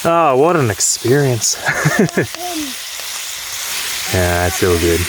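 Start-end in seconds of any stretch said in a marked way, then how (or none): 1.6-4.1 breathy laugh. Real, not acted. Laughing at his own situation.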